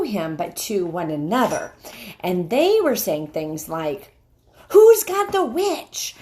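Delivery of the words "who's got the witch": loud, high call across the battlefield; urgent shout. Acted. Rescuer calls out to the others, loud and urgent. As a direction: shout it out over the chaos.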